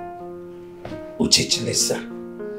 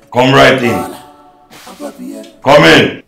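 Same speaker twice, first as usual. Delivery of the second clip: loud, raised call to the door; 8 semitones higher, 11 dB louder, slower than usual. Acted. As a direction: project it across the office. Calling to someone at the door.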